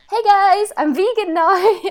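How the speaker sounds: high, loud bright cheer of Hey guys, I'm vegan now; excited announcement. Retold or imitated. Telling coworkers she went vegan, loud excited announcement. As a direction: announce it to the office, proud and loud. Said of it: "going into work and being like".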